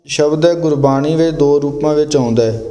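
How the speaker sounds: ordinary talk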